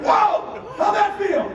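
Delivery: very high, loud whoop; thrilled shout. Retold or imitated. Dad to son after a birdie, thrilled shout. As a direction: whoop it in amazement.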